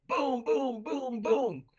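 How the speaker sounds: high, punchy booms imitating wall banging; imitated pounding. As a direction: sound out heavy knocks, beat by beat. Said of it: "pounding on the walls".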